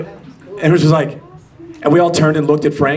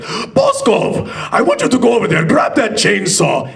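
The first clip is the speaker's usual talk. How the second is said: high, emphatic mock command; calling out orders; 6 semitones higher than usual. Actor improvising impossible orders for Frank's bear, calling out commandingly. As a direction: bark made-up orders from on high.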